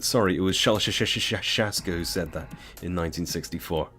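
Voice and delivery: plain reading voice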